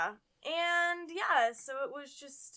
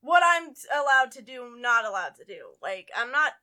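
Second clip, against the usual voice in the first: loud, emphatic retold retort, pitched up then falling; affronted reenacted clapback; indignant comeback; 2 semitones higher, 10 dB louder than usual. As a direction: challenge the scold loudly, reenacting it. Retold confrontation with scolding stranger.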